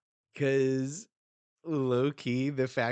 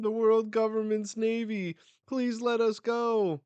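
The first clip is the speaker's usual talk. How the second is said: much higher and louder, begging; desperate plea; 11 semitones higher, 7 dB louder than usual. Acted. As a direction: cry out for mercy. Slaves begging for release.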